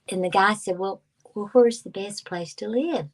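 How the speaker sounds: ordinary delivery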